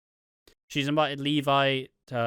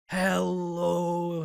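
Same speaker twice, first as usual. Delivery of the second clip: "Hello" drawn out, higher-pitched; very slow; 7 semitones higher than usual. Acted. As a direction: stretch "Hello" as if dumbed down for Americans. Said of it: "it's very slow, very".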